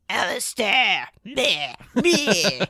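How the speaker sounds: disdainful Orlesian woman, drawn out; mock disgust in a hammed-up Orlesian accent; Isolde. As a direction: sneer it like a haughty Orlesian lady. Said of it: "that's my Orlesian woman".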